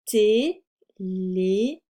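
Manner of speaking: TÉLÉPHONE very slowly, broken into té, lé, phone; syllable-by-syllable dictation. Shown as a demonstration. Teacher breaks up the word for spelling, syllable-by-syllable dictation. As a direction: separate each syllable of téléphone with clear gaps. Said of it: "nous allons faire une dictée de mots".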